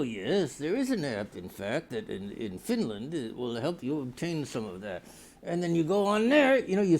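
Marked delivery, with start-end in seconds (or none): 0.0-5.0 low, soft robotic assistant voice; the app replying in a low quiet drone. Pretend. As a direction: answer like a dull machine. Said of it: "And they go".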